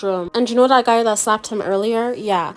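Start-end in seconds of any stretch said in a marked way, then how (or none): none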